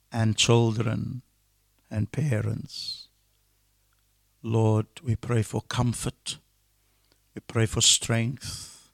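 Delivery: subdued pastoral prayer, with pauses. Real, not acted. Leads a prayer.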